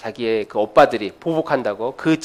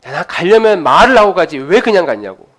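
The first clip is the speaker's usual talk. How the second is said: 라반 흉내; 2 semitones higher, 7 dB louder than usual. Acted. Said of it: "라반스러운 스타일로".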